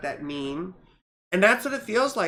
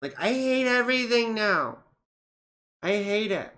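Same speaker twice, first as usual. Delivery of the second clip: emotional venting; 4 semitones higher than usual. Real, not acted. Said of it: "I'm way too ... emotional".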